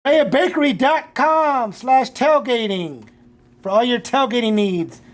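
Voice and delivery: slow announcer ad read. Acted. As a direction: spell out the web address slowly.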